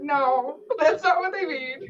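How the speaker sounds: giggling through the quote; laugh-filled retelling. Real, not acted.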